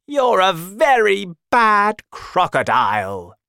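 high, loud telling-off; outraged storybook elephant shout; angry elephant. Acted. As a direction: scold the villain at full voice. Elephant scolds crocodile.

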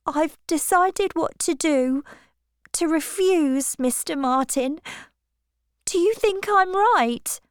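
put-on high, girlish and breathless with news; a young girl bursting with a proposal; flustered young Harriet. Harriet telling Miss Woodhouse about the proposal, high flustered girl.

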